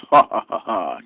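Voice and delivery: big mock hearty laugh, fading out at the end; a hammed-up villain laugh, ha ha ha. Shown as a demonstration. Gamer after shooting aliens doing a mock hearty laugh. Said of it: "big, giant, hearty laugh".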